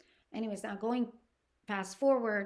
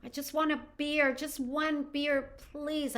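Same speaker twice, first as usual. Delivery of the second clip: desperate pleading; 6 semitones higher, 4 dB louder than usual. Retold or imitated. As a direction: imitate someone desperately begging for a drink. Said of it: "she was desperate for a drink".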